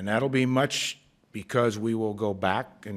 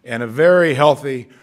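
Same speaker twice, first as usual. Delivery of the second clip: loud birthday greeting; 5 semitones higher, 12 dB louder than usual. Retold or imitated. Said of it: "I would always end it with".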